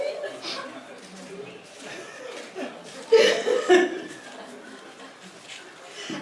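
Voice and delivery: high, breathy laughter; real laugh at the joke. Real, not acted. Speaker laughing after the sulky line, breathy and high.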